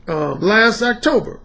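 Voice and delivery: ordinary delivery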